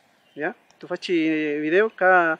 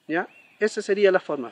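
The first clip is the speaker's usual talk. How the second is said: ordinary talk, nothing marked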